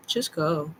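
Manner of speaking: ordinary talk, nothing marked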